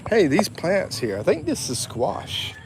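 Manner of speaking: ordinary talk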